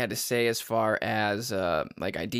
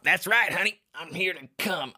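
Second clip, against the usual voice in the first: sleazy customer voice; 6 semitones higher than usual. Pretend. Mock strip club customer.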